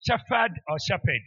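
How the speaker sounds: first "Shepherd" as SHAF-ad, second as SHAP-ed; contrasting pronunciations. Shown as a demonstration. Preacher asking Magdalene which is right, "Shepherd" as SHAF-ad or SHAP-ed. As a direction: set "Shepherd" with f against "shepherd" with p. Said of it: "what should I say?".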